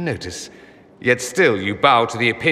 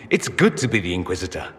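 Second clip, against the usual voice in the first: normal talking voice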